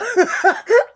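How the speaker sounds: loud, high-pitched laugh; laugh through emotion. Real, not acted. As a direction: burst out laughing to avoid crying. Laughing off the urge to cry.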